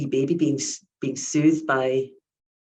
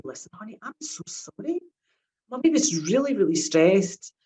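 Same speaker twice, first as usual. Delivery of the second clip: soothing apology; 5 semitones higher than usual. Retold or imitated. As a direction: gently make up with the child. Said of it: "if you're a sensitive parent".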